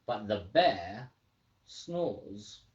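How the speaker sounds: ordinary talk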